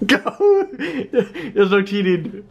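laughing. Real, not acted. Laughing at sibling.

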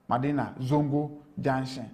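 slow "Madina. Zongo. Junction." with gaps; word by word. Real, not acted. Reporter names Madina Zongo Junction for the host, slowly, word by word. Repeats place name word by word.

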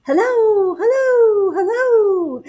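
high, loud calls ringing off mountains; echoing shout. A narrator's reading. Bear's call bounces off the mountains, echoing. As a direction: repeat the call like a mountain echo. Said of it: "his own voice echoed off".

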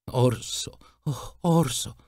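put-on a touch of a sobbing child; sobbing call. A narrator's reading. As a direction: call his name through light sobs. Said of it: "szlochając dziecko".

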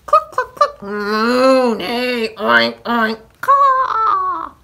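loud run of animal noises; farmyard racket. A narrator's reading. Every animal waking the farmer at once, clamouring. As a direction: rattle off clucks, moo, neigh, oinks and a caw. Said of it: "all at the same time".

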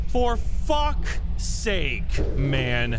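slow frustrated groan; exasperated drawl. Real, not acted. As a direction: drag it out, fed up. Drawn out exasperation.

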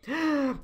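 short, high gasp of alarm; acted gasp. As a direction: gasp as if nearly discovered. Gasp as searchers come close.

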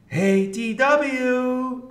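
high, loud, drawn-out call. A narrator's reading. Arthur to D W in another room, calling out. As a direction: sing out her name across the house. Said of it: "Arthur called".

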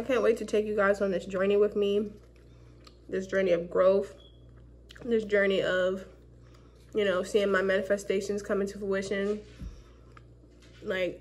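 muffled through fingers; speaking behind hand; hand over mouth. Real, not acted. Seen 2: Fingers cover her lips through all labelled words; absent before.

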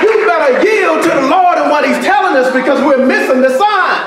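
rising shout from the pulpit; preaching at full force. Acted. Pastor preaching to the congregation, shouting.

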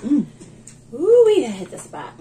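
loud, high happy ooh; satisfied delight. Real, not acted. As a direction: delight in the food. Satisfied after a bite.

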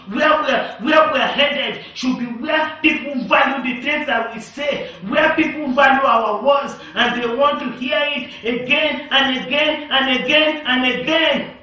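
loud, rising shouted preaching; fiery pulpit shout; preaching at full force. Real, not acted. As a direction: drive it home to the congregation. Preacher shouts again at climax.

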